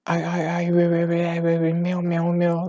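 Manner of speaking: stuttering glitchy stream voice; glitchy echoey robot voice. Shown as a demonstration. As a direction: skip and echo like bad audio. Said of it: "it sounds like a stupid echoey robot".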